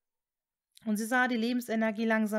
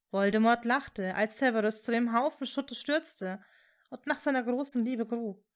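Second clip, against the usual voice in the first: ordinary delivery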